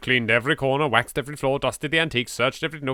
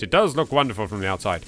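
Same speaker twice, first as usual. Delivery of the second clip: ordinary delivery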